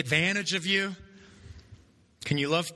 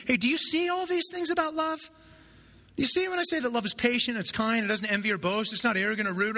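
higher, lightly voiced Paul pressing his point; Paul challenging; 5 semitones higher than usual. Paul challenging the Corinthians, lightly voiced and higher. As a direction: lift the voice and press them hard. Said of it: "what he's really saying is".

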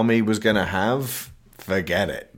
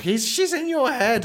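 mock warning. Acted quote in recap.

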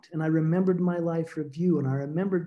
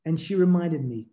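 ordinary talk

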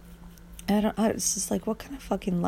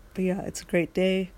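ordinary talk, nothing marked

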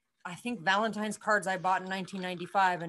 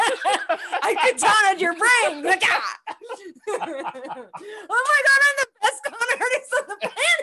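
high, loud squeals through laughter; shrieking with helpless laughter; hysterical laughing; 11 semitones higher, 6 dB louder, slower than usual. Real, not acted. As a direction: barely get it out while cracking up. Helpless laughter at own slip.

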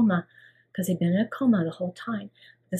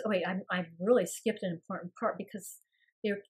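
usual speaking voice

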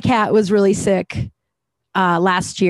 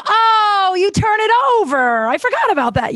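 high, bright sudden oh; imitating her own surprised discovery; mock realization; 12 semitones higher than usual. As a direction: act out a forgetful light-bulb moment. Sudden realization retold inner voice.

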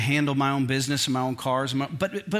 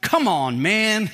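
louder, exasperated plea to God; a fed-up cry heavenward, half joking; mock exasperated prayer, louder; frustrated prayer; 5 semitones higher, 5 dB louder, much slower than usual. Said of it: "It was a frustrated prayer".

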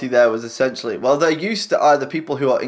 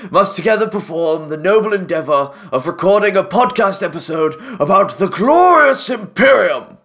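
town crier; 5 semitones higher than usual. Pretend. Mock royal proclamation continues.

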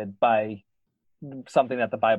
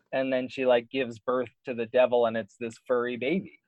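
usual speaking voice

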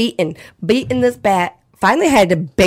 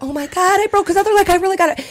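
high, panicky squeal; reliving the horror, pitch climbing; retold panic; 10 semitones higher, faster than usual. Recounting breaking the possum's leg, high and panicked. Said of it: "I'm like".

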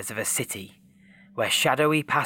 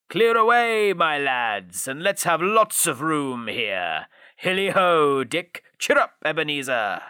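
rally the lads to clear the floor; merry shouting; 4 semitones higher than usual. A narrator's reading. Fezziwig orders the room cleared.

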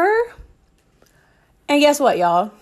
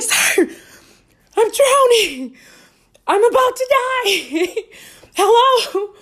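pitched way up, slow desperate wail; mock drowning cry; high panicked plea; 11 semitones higher, slower than usual. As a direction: beg the lifeguard, voice high and strained. Retold desperate plea to lifeguard.

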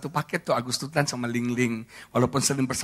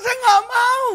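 whiny refusal; 5 dB louder, slower than usual. Retold or imitated. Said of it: "Saya bilang".

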